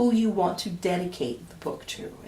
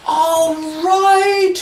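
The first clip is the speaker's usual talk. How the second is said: loud, higher call; mayor's cry; 9 semitones higher, 10 dB louder than usual. Acted. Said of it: "cried the mayor".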